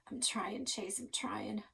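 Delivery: struggling, fading strength; weak strained whisper. Acted.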